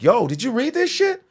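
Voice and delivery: retold outrage. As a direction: tell them it's outrageous. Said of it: "I was like".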